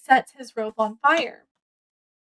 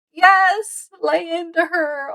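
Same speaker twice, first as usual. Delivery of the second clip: excited cheer; 5 semitones higher, slower than usual. Retold or imitated. Reader cheering the principal scolding the bully, excited. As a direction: cheer him on like a fan, pitched up. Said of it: "and I was like".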